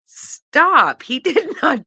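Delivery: high, loud laughing stop; squealed stop through laughter; laughing protest. Real, not acted. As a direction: crack up and cry stop. Reacting to shocking gossip.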